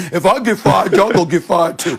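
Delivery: mock coach speech. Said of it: "what coach gives a motivational speech by saying".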